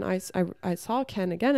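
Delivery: normal talking voice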